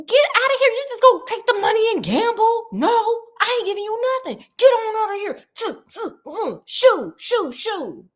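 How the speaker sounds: hammed-up father shooing his son out the door; mock angry father. Alexander throws out son.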